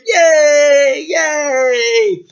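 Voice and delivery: very high, loud jubilant cheer; crowd cheering. Acted. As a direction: shout for joy like a whole town. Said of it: "everyone cheered".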